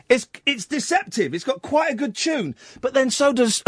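ordinary talk

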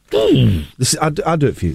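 ordinary talk, nothing marked

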